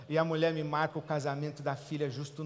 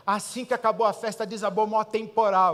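excited churchgoer; 5 semitones higher, 8 dB louder than usual. Retold or imitated. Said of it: "as pessoas vêm assim".

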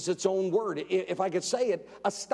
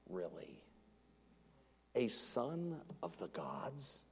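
mock disbelief; 8 semitones lower, 14 dB quieter, slower than usual. Incredulous mocking question.

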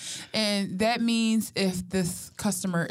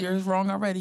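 ordinary talk, nothing marked

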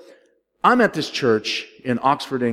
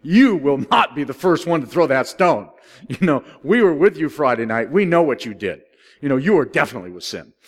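put-on loud teasing crowd of old friends; lightly voiced jeering friends, a bit louder; mocking buddies; 4 semitones higher, 7 dB louder than usual. Pharisees calling out a friend, mild loud mockery. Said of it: "They go".

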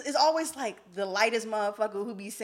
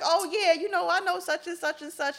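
imitating grandma; 10 semitones higher, 6 dB louder than usual. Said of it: "she was like".

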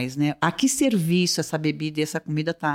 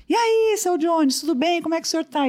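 loud greeting, acted at much higher pitch; speaking loudly; 11 semitones higher than usual. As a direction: imitate a doctor calling to someone hard of hearing. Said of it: "Aí ele diz alto, ele aí fala alto".

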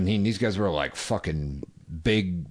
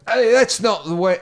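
high, loud attempt at an Irish accent; badly faked angry Irishman; failed Irish accent; 13 semitones higher, 7 dB louder than usual. Retelling an Irish guy's objection, badly faked high, loud Irish accent. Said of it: "I can't do an Irish accent".

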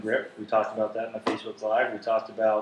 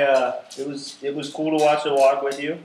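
ordinary talk

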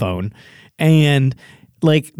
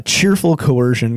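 usual speaking voice

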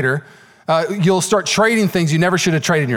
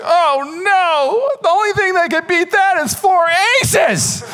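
much higher, hammed-up fake dismay with a laugh; mock dismay; 13 semitones higher, much slower than usual. Teen fooling his beaten friend, hammed-up mock dismay. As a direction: pretend to be worried while laughing. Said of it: "I was like".

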